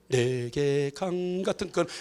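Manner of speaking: slow, low, quiet murmured hymn line; dragging, joyless hum; gloomy mumbling. Retold or imitated. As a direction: mumble it with a sullen face. Zacchaeus climbing gloomy.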